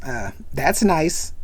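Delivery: slow, unimpressed shrug of a line. Retold or imitated. Yellow Shirt unimpressed.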